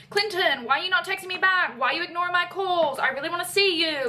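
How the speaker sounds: put-on lovesick pestering; pretend obsessed stalker. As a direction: play a clingy fake stalker, lightly. Voices scripted obsessed stalker lines.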